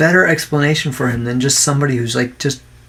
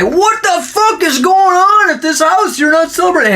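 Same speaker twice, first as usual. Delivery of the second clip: mock angry yell. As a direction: pound on the door and demand it, hammed up. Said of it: "come beat on your door and say".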